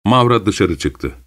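ordinary delivery

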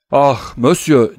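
loud, drawn-out anguished appeal; crying out. A narrator's reading. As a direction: wail out to your master. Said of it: "diye bağırıyordu Barois".

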